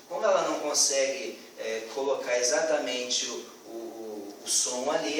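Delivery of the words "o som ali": normal talking voice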